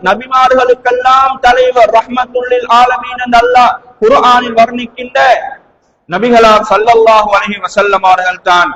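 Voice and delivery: ordinary talk, nothing marked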